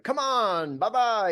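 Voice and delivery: high, pushy cajoling call to a flat crowd; goading the crowd. Retold or imitated. Performer goading a tepid audience, high and pushy. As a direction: needle the audience into cheering. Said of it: "saying to the audience out loud stuff like".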